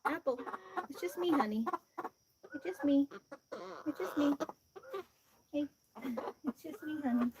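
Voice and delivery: soft, hushed reassurance; calm a scared hen gently; soothing hen. Real, not acted. Owner soothing a scared hen at bedtime, soft.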